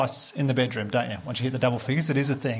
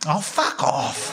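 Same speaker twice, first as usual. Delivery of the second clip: slow, drawn-out curse at full voice; out loud; much slower than usual. Retold or imitated. Annoyed man to the kissing couple in the cafe, out loud. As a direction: let the curse out openly, stretched long. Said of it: "but out loud I just went".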